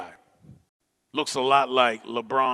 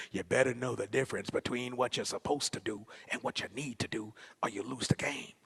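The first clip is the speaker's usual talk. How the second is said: coach's voice in the ear; 2 semitones lower, 9 dB quieter than usual. Retold or imitated. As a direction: murmur the lesson over someone's shoulder. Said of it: "it's like my coach was right behind me".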